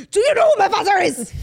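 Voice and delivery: aggressive, high shout in a put-on Austrian accent; hammed-up Austrian-accented tantrum; mock Austrian accent. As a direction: bellow it like an entitled drunk Austrian. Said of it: "I wanna say they're Au-, Aus-, Austrian".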